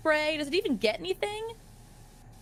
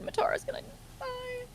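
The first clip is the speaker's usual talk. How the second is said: breathy unvoiced whisper, higher; 11 semitones higher, 6 dB quieter, faster than usual. Whispering a mock lament about the friend. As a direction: whisper it like a sad secret.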